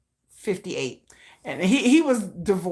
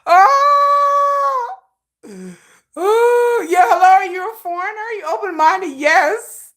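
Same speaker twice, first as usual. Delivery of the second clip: high, loud whooping exclamation; squealed, over-the-top enthusiasm; excited outburst; 13 semitones higher, 8 dB louder, much slower than usual. Real, not acted. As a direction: burst out shouting with glee. Excited outburst after laughing fit.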